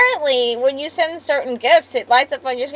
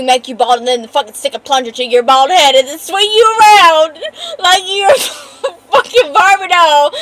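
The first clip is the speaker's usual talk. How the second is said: usual speaking voice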